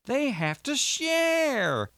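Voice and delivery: "share" stretched very long and high; great emphasis on share. A narrator's reading. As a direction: lean hard on "share", drawing it out. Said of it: "putting great emphasis on that word".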